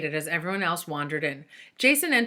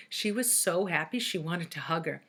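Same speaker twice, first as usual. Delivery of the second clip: plain reading voice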